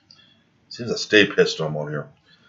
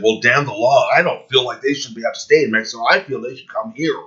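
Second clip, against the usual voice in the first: mocking quote; 5 semitones higher than usual. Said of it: "we got people out here saying".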